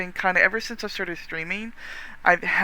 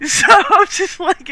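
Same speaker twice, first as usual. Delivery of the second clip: laughing; 12 semitones higher, 11 dB louder, slower than usual. Real, not acted. Laughing at own memory.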